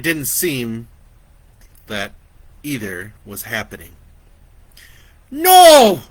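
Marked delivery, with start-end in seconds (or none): 5.3-6.0 high, very loud scream of no. Acted. Vicky screams at the chainsaw man. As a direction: scream it at the attacker. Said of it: "Vicky screamed".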